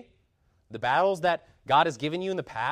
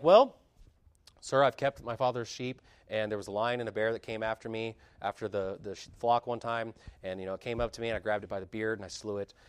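softer, offhand retelling of a feat; nonchalant; 5 semitones lower, 5 dB quieter than usual. Retold or imitated. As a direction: shrug off killing a lion and bear. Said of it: "saying it so nonchalantly".

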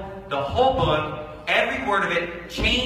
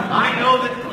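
normal talking voice

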